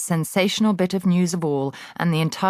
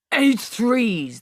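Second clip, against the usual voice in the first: higher, louder bark; shouting; 8 semitones higher, 3 dB louder than usual. A narrator's reading. Headmistress shouting at the dangling boy. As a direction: shout it while swinging him. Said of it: "the Trunchbull shouted".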